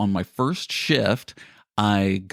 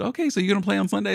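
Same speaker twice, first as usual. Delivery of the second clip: higher, fast ribbing question; mimicking joking guys; 10 semitones higher, much faster than usual. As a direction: poke at him for more games. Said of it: "the guys were joking and said".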